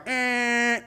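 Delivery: high, held buzzer noise at modest volume; imitated door buzzer. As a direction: mimic a plane's alarm buzz. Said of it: "they make this loud noise like".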